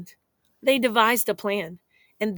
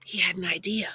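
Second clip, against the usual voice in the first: whisper; 5 dB quieter, faster than usual. A narrator's reading. Storyteller hushes for suspense.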